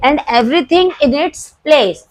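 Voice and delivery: very slow, word by word; slow dictation. Shown as a demonstration. Teacher dictating the second half to the class, slow. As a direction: dictate it so students can copy. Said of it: "teacher dictating principle".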